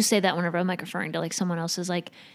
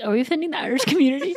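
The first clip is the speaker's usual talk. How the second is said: fast mock worry; 6 semitones higher, much faster than usual. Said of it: "Oh, I was like".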